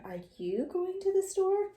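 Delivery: "Are YOU going to the store?" lifted high as a question; asked as a question. Shown as a demonstration. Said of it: "it as a question".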